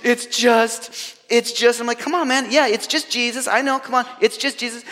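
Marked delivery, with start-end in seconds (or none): 0.0-1.8 high mock-tearful stammer; high, halting imitation of a crying boy; imitating choked-up crying. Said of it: "trying to get his composure". 2.0-4.8 high-pitched, eager prodding; hurried, knowing encouragement, pitched high; impatient coaxing. Retold or imitated. Youth worker coaxing crying boy, retold.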